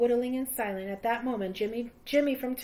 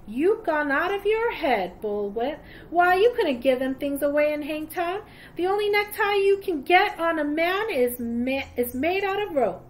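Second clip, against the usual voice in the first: scoffing; 6 semitones higher, 6 dB louder than usual. Acted. Miner scoffing at Praiseworthy.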